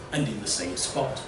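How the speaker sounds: ordinary delivery, nothing marked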